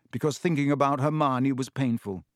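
ordinary delivery, nothing marked

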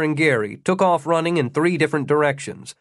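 usual speaking voice

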